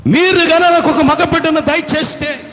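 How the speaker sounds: loud, high pleading prayer; fervent plea. Acted. Barren wife pleading for a son, loud. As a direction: beg God for a son.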